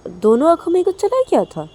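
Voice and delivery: high, loud mocking question; mock her excuse in a high loud voice; sarcastic mother. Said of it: "तो तभी मनीषा की बोलती कि". (ताना मारती माँ, ऊँची आवाज़ में)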